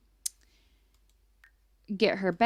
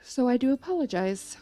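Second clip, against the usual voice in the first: said through a yawn; words stretched by a yawn; 3 semitones higher than usual. Real, not acted. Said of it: "Whew, I just yawned".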